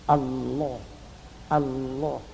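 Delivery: Allah with a thick, full lam sounding like "Alloh"; Allah, the lam made thick and full like "Alloh". Shown as a demonstration. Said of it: "Ini harus dibaca tebal".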